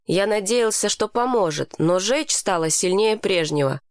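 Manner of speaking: ordinary delivery, nothing marked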